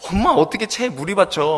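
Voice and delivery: high, loud mock disbelief; incredulous son. Son told to catch water in sieve, incredulous protest. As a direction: protest loudly as a baffled son.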